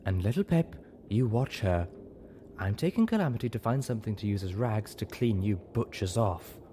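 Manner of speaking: lightly voiced haughty mare giving orders; a touch of prim superiority; a hint of a bossy nurse; ordering. A narrator's reading. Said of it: "Velvet ordered".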